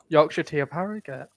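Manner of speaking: usual speaking voice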